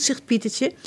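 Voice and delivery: plain reading voice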